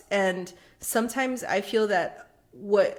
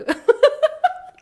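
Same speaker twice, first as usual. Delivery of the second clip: high-pitched burst of laughter; 8 dB louder than usual. Real, not acted. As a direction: break into a squealing laugh. Laughing at the NPC story.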